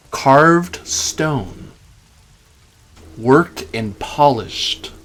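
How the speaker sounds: ordinary talk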